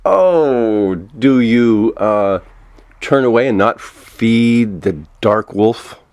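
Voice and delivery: ordinary talk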